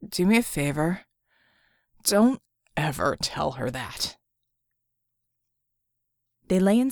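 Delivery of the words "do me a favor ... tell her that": croaking. A narrator's reading. Red asks a favour of a companion, croaking. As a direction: croak it out low, dragging the last words. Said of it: "Red croaked".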